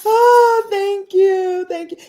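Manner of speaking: high, gushing thank-you starting loud; effusive thanks. Real, not acted. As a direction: squeal your thanks with delight.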